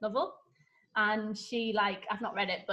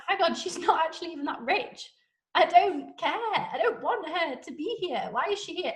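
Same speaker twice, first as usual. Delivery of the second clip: higher, indignant mock general voice; haughty general; 6 semitones higher than usual. Snobbish general realising she's not rich, indignant higher voice. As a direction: play him as a huffy snob. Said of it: "General Tilney's like".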